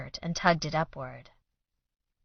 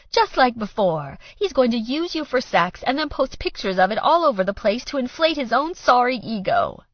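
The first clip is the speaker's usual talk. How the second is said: high, loud bitter rant; 7 semitones higher, 5 dB louder than usual. A narrator's reading. Mother ranting to daughter, bitter and loud. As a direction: tear into him.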